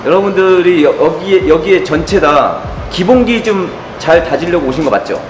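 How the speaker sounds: ordinary delivery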